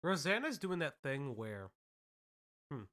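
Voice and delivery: talking through a mouthful of bagel; chewing while talking, mouth full. Real, not acted. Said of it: "I have bagel in my mouth".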